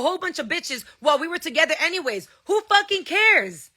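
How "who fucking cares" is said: higher and slow, scornful; angry scoff. Real, not acted. Mother scoffing at her ex's cheating, angry and slow. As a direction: drag it out in disgust. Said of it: "I'm sorry that I'm angry".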